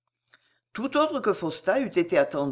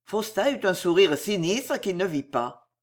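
ordinary delivery

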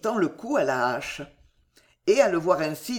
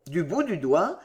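ordinary delivery

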